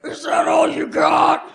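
challenge. Pretend. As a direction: throw down the gauntlet, loud and slow. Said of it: "turn to them and go".

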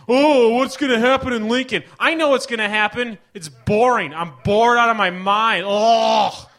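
loud, high sneering rant; hammed-up contempt; mock scorn. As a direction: scoff at the movie.

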